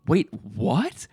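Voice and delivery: jaw-dropped double take, rising at the end; stunned disbelief. Retold or imitated. Viewer shocked by the movie, stunned questioning. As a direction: retell a baffled reaction, question lifting. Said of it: "made my jaw drop in, like, shock and awe".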